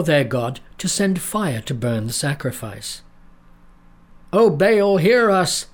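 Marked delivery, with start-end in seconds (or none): 4.3-5.7 high, loud pleading cry. A narrator's reading. Prophets of Baal begging their god, loud cry. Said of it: "they cried".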